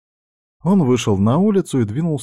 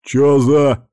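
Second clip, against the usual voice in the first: loud, raised, baffled blurt; 5 semitones higher, 6 dB louder than usual. A narrator's reading. Red-haired thug hit by a flying paving tile, baffled. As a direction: blurt it out, caught off guard. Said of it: "не понял рыжий".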